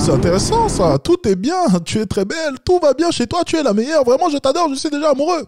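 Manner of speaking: loud, very high-pitched lovestruck gushing; mock fawning. Coach parodies the client flattering the woman, gushing shrilly. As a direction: pile on hammed-up adoration in falsetto.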